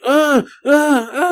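high-pitched wordless "uh" bursts; mimicked excited passenger noises; imitated urgent grunts. Imitates passenger urgently signalling driver.

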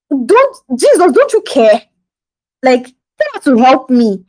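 high, slow, hammed-up whine of protest; exasperated complaint. Martha comparing herself to her sister, complaining to Jesus. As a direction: plead your grievance in mock exasperation. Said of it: "she's comparing. She's like".

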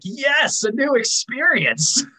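high, gleeful mock delight; mock excited. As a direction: squeal it like a thrilled fan. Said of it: "I'm like".

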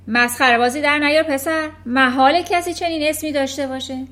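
loud, high furious scolding; furious headmistress. Acted. Headmistress Trunchbull bellowing at a small boy in fury. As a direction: berate the boy like a tyrant.

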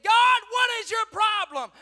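high, very loud challenge to God; blaming shout. Retold or imitated. Joshua blaming God after defeat, loud blaming shout. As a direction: cry out in blame. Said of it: "on his face blaming God".

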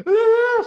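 loud, high crying wail; mock wailing. Imitating friend crying down the phone, mock wail. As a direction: burst into an exaggerated sob-scream. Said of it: "chorando muito".

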